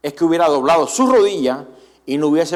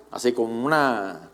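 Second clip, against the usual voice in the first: normal talking voice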